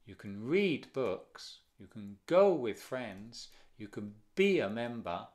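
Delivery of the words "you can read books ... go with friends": slow, with "read" and "go" stressed; stress on the verb. Shown as a demonstration. Teacher modelling sentences for the class, slow stressed verbs. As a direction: stretch it out and punch "read" and "go". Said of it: "Va en el verbo".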